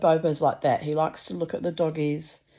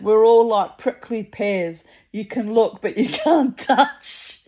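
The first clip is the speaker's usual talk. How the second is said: joking with a laugh, slow and louder; half joking; 4 semitones higher, 7 dB louder, slower than usual. Retold or imitated. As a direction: tease them playfully, laughing at the end. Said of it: "half jokingly".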